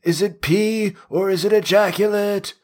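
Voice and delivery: high, desperate calling after her; pleading Merlin. Retold or imitated. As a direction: beg her to come back. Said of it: "Merlin's like".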